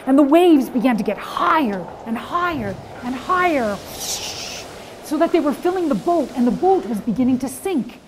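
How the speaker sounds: dramatic rising storm narration; building storm. Shown as a demonstration. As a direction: let the storm swell. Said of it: "loud or soft".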